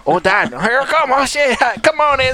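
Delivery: high-pitched stoner comic impression; dog enjoying a rub in a high, put-on voice; Cheech.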